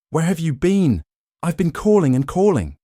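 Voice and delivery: high, loud frantic pleading; desperate, words tumbling out; panicked. A narrator's reading. Panicked wife begging husband who left, high and loud.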